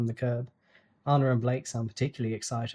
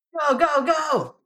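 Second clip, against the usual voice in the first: high shouted urging; cheering; 8 dB louder, much slower than usual. A narrator's reading. Teammates urging the runner on, shouted. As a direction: yell him on to run.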